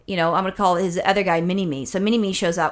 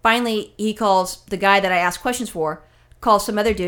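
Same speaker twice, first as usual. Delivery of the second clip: ordinary talk, nothing marked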